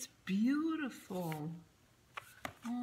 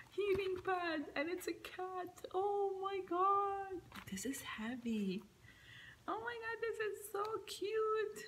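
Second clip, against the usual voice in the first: much higher, squealing with delight; thrilled squeal; 12 semitones higher than usual. Real, not acted. Thrilled by a cat heating pad.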